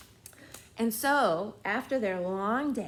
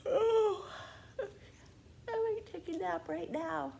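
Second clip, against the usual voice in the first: sleepy voice; 10 semitones higher, slower than usual. Pretend. Sleepy after yawn song.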